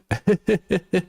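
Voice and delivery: a high giggle in Blue's manner; high mock laugh. Streamer mimicking Blue's laugh, high giggle. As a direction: toss off a silly high laugh. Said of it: "that was my impression of Blue".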